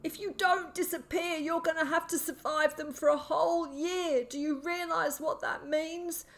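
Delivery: high, desperate madman's voice, slowing toward the end; terrified warning. A narrator's reading. Sick man warns boy of year-long hunt, terrified. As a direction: make him grasp the danger. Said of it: "His eyes were wide with terror".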